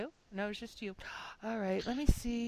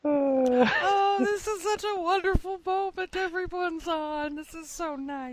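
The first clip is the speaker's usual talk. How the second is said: gushing delight; 11 semitones higher, 3 dB louder than usual. Pretend. Host gushing that everyone is on the call, delighted. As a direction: coo over it in a high voice.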